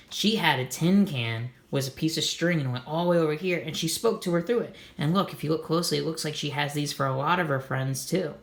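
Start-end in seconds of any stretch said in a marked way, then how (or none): none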